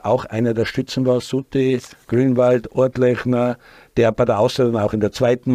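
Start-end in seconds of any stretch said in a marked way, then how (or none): none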